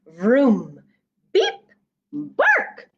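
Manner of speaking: vroom beep then a high bark; truck puppy noises. Acted. Reader voicing the puppy truck's vroom, beep, bark.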